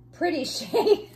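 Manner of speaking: high, laughing through the words; giggling at own slip. Real, not acted. Laughs after nearly saying shitty.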